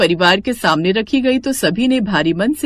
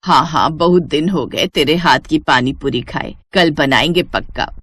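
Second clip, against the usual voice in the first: lightly voiced village mother; 6 semitones lower than usual. Mother agreeing to her son's cooking, lightly voiced mother. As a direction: lower voice of a mother.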